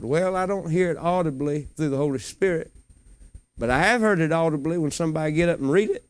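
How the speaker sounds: ordinary talk, nothing marked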